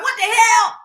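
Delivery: high, loud imitation of an outraged Southern grandma; grandma's exclamation. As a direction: blurt it out as grandma would, pitch shooting up. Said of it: "She used to be like".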